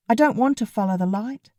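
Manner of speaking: ordinary delivery, nothing marked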